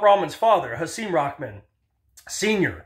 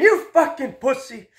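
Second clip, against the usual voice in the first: heckling fan; 7 semitones higher than usual. Retold or imitated. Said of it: "And Claudio again".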